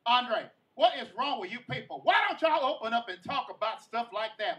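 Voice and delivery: loud, high, exasperated scolding. Retold or imitated. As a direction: snap at him like a fed-up coworker.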